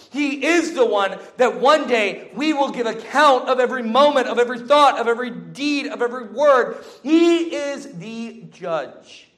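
rising fervent sermon voice; preaching. Acted. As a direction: build it up, preacher intensity. Preaching builds to climax.

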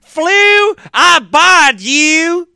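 loud, high Southern proclamation, long drawn out; mock Southern accent. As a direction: bellow it like a Southern faith healer. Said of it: "when you say it with a Southern accent".

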